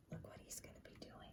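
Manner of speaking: quick, hushed whisper; confiding whisper to the listener; whispered aside. Real, not acted. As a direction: whisper it like a secret.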